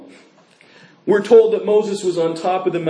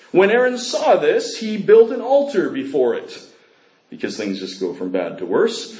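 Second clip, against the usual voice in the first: normal talking voice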